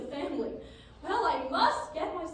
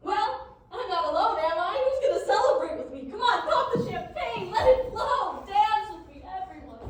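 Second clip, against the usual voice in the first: gloating laugh; 3 semitones higher, 7 dB louder than usual. Acted. Son gloats, calls for celebration.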